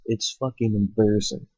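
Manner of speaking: ordinary delivery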